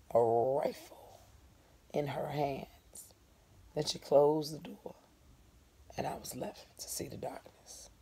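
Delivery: slow, soft whisper; hushed narration. A narrator's reading. Narrator revealing the rifle, hushed whisper. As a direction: let the fear creep in.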